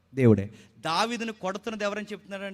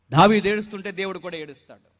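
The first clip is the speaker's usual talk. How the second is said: ordinary talk, nothing marked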